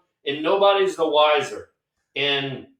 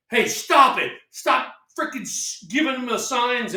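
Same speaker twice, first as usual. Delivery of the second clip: mock scolding; 7 semitones higher than usual. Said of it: "turn around and go".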